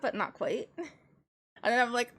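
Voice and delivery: usual speaking voice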